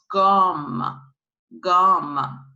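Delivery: gum said as French gamme, "gahm"; French "gahm" for gum, hard g. Shown as a demonstration. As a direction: start gum with hard g, open "ah". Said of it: "so you pronounce gum".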